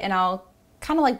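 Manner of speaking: ordinary talk, nothing marked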